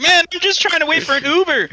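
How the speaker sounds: high-pitched mock pleading; retold protest. Stranded rider explaining himself to the homeowner, high retold protest.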